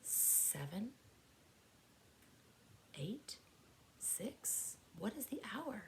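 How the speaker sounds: soft whispered numbers, drawn out; whispered counting. Pretend. Teacher building suspense before the next clock, whispering. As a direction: count down in a hush.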